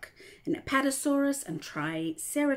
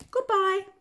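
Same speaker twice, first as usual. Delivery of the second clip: high, loud goodbye called out; calling goodbye; 11 semitones higher, 8 dB louder than usual. A narrator's reading. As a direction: call it out to the departing children. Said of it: "called Mum".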